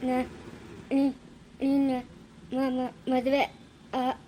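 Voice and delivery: slow, halting stutter; low start, struggling word by word; stammering. Acted. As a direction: catch on first syllables, then drag the word out. Halting, stuttered announcement of a marriage.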